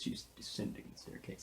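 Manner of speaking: airy stage whisper; hushed. Shown as a demonstration. Reading how I wrote the scene, hushed whisper. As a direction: whisper it reverently. Hushed repeat as script direction.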